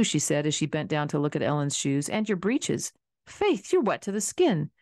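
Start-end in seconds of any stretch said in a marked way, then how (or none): 2.1-4.7 higher, dismayed exclamation; a mild mock alarm at the wet clothes; cried. A narrator's reading. Farm wife fussing over wet child, mild cry. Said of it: "she cried".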